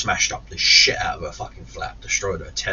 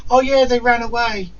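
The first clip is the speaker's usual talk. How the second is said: high, airy put-on voice; mocking house sitter; 14 semitones higher, 4 dB louder than usual. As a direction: mimic her offhand excuse way up high. Mimics the house sitter's excuse.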